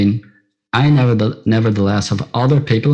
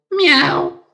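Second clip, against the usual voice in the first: high plaintive kitten cry; a small crying mew; crying kitten meow. A narrator's reading. Jasmine answering to her new name, plaintive mew. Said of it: "And Jasmine said".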